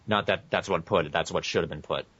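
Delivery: ordinary talk, nothing marked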